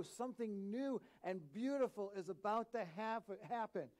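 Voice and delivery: high, insistent rhetorical pleading; impassioned urging. Acted. Acts out God's urgent plea.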